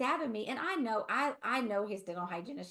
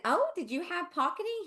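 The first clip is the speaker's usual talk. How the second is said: surprised question; 9 semitones higher than usual. Retold or imitated. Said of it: "And I said".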